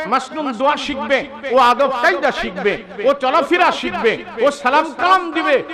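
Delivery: normal talking voice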